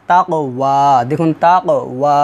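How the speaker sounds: slow "তাকওয়া" with a long "ওয়া"; "ওয়া" held open, measured; one-alif stretch. Shown as a demonstration. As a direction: draw out the final vowel of "তাকওয়া". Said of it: "ওয়ার মধ্যে এক আলিফ টান দিবেন".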